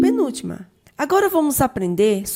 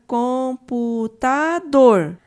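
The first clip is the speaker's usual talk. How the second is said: "computador" slow, syllable by syllable, landing on "dor"; stress on final syllable; 3 semitones higher, 4 dB louder, much slower than usual. Shown as a demonstration. Teacher showing syllable separation of "computador", stress on "dor". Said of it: "A sua sílaba mais forte".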